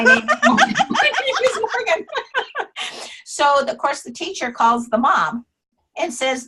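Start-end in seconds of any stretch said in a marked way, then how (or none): 0.0-3.1 real laughter breaking into the words; can't get the line out without laughing; laughing through it. Real, not acted. Teller cracks up retelling punchline.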